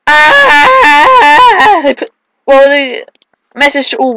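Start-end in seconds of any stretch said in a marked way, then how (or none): 0.1-1.8 long high-pitched yell of alarm; high scream. Real, not acted. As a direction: let out a long high shriek. Falling on rope swing.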